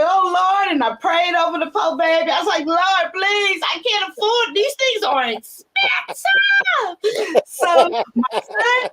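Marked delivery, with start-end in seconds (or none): 0.0-7.0 high pleading cry to the Lord; mock wailing prayer, rising high; retold desperate prayer. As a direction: beg the Lord, voice climbing high.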